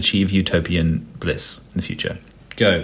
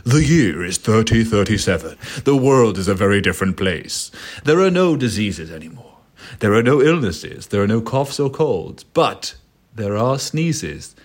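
dramatic narrator; 3 dB louder than usual. Acted. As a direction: announce the future like a movie trailer. Sets up the scenario.

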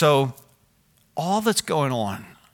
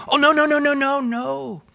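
much higher put-on neighbor voice; 11 semitones higher, 4 dB louder than usual. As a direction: squeal out a high insistent no. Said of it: "I go".

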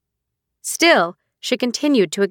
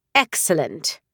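icy; 4 semitones lower, 3 dB quieter than usual. Acted. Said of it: "said Shahira icily".

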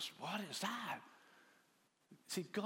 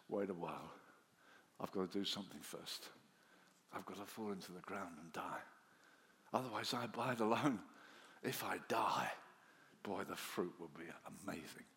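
low and soft, almost hushed; Jesus gently tells them to wait, low and soft; gentle and low; 9 semitones lower, 6 dB quieter than usual. Acted. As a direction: speak softly as Jesus explaining his death. Jesus voiced gently about dying.

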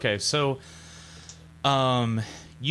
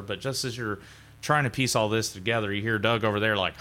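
usual speaking voice

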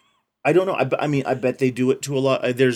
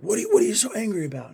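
put-on puzzled old man; 9 semitones higher, much faster than usual. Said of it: "he's like".

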